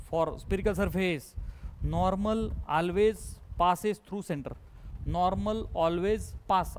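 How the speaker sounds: very slow, word-by-word dictation. Real, not acted. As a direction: leave time to write each word. Dictates notes to write down.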